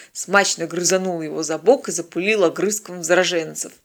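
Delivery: ordinary delivery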